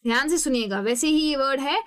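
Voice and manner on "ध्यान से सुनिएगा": normal talking voice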